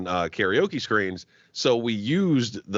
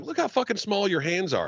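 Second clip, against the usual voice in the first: teasing; 9 semitones higher than usual. Retold or imitated. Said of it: "I was like".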